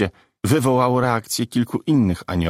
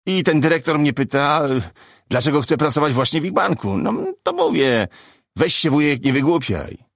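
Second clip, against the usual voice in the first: higher-pitched comic finishing his bit; stand-up routine voice, raised in pitch; 5 semitones higher than usual. Acted. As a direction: deliver the punchline to the room, pitched up. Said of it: "dokończył swój żart stand-uper".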